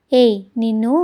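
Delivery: playfully threatening growl; hey-you scolding, half in jest; mock angry. A narrator's reading. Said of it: "గుద్దాడు కోపంగా".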